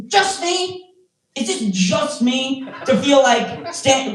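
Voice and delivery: high, mock-indignant questioning; incredulous appeal. Comic asking crowd for agreement, incredulous.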